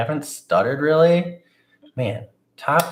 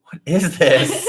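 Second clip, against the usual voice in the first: baffled; 5 semitones higher, slower than usual. Pretend. Baffled by a muffin.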